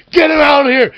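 high, loud furious yell; angry shout. Retold or imitated. Braun Strowman yelling to the bartender, angry shout. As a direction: order him thrown out at full volume.

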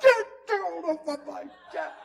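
high, drawn-out shout; hammed-up screamed order; exasperated yell. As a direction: holler it over the top, half laughing. Exasperated reply to shouting man.

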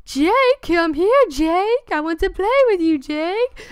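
high, drawn-out taunting call; haunted-house scare actor. Retold or imitated. Haunted house guy trying to scare him by name, creepy taunting. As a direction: imitate a creepy spook calling someone to play. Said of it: "trying to scare me, he was like".

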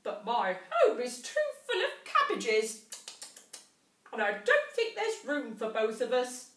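a rabbit chirping that there is no room; twittering rabbit. A narrator's reading. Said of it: "twittered Rabbit".

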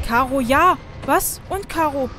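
frantic excited. Real, not acted. Frantic puzzle solving.

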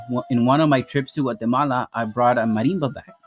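ordinary talk, nothing marked

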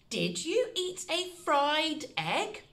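sing-song slow question. Shown as a demonstration. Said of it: "The second one is".

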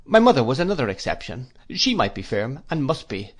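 ordinary delivery

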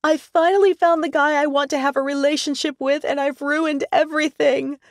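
sobbing it out against his chest; tearful. A narrator's reading. Woman collapsing into her friend's arms, in tears. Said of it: "tears burning her eyes".

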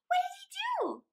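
put-on very high, near-crying child's voice; anxious girl. A narrator's reading. Mary, near tears, begs her father for the rest of the story. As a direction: ask in tearful worry like a small girl.

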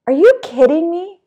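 mock-incredulous question. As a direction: voice a neighbour who can't believe it. Neighbour's disbelief, retold.

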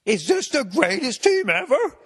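oversell the question like a TV pundit; mock sports-show hype; Kornheiser hype. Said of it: "Tony Kornheiser".

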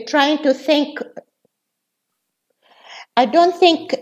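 ordinary delivery

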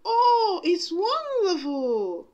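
overjoyed, drawing out the wonder; exclaiming. A narrator's reading. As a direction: Cinderella thrilled at the dress. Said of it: "Cinderella exclaimed".

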